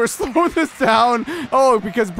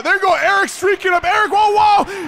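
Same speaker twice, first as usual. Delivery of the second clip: much higher, shouted breakaway call; excited play-by-play; 9 semitones higher than usual. Acted. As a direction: yell the rush as it happens.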